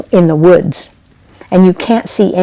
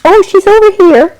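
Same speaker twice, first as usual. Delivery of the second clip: very high, louder, gushing welcome; high sing-song neighbor voice greeting a visiting girl; delighted neighbor lady; 10 semitones higher, 4 dB louder than usual. A narrator's reading. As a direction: greet her like an overjoyed old friend. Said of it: "She said".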